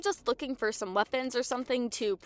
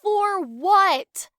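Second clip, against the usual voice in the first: raised, high indignant protest; exasperated outburst; 5 semitones higher, 4 dB louder than usual. Acted. Arguing with a friend about the quest, exasperated. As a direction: burst out in frustration. Quarrel over volunteering for quest.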